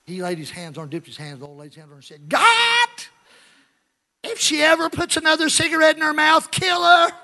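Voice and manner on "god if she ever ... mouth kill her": imitating a shrill preacher at full cry; Schambach. Preacher praying over the smoking woman, shrill shout. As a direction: scream the prayer high and loud. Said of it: "he had this real shrill voice".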